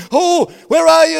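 jailer calls out high and loud in panic; a frightened man shouting into the dark; panicked jailer's cry. Acted. Panicked jailer yelling for the escaped prisoners, loud and high.

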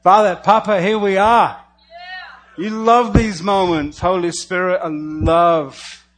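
loud, lifted call to the Father; loud prayer. Acted. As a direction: preach the prayer out at full force.

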